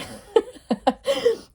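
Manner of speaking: squealing high laugh; high giggle. Real, not acted. Reader laughs at her own joke, squealing giggle.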